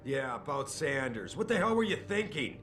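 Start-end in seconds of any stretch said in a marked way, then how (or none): 1.4-2.6 angry boss. Acted. Boss confronts his agent.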